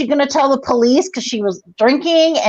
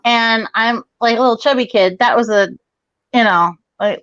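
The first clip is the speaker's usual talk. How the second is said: usual speaking voice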